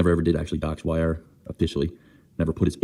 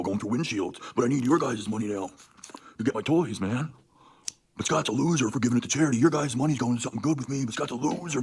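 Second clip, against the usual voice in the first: higher sneering put-on voice, climbing higher as it goes; mocking imitation; 6 semitones higher than usual. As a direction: parody the rival's smug whine, getting higher. Mocking Tony's reasoning in put-on voice.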